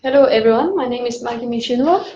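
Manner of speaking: ordinary delivery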